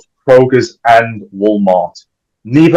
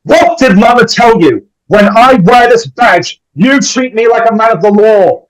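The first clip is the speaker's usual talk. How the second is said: embarrassed scolding; 9 semitones higher, 3 dB louder than usual. A narrator's reading. Dewey, red-faced, scolding his sister Tatum, indignant. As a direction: puff up and scold like a wounded deputy.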